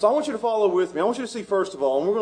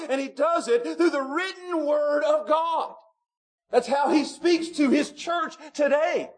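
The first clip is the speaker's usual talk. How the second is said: higher, shouted preaching; preaching at full force; 6 semitones higher than usual. Acted. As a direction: drive it home to the church. Preaching climax.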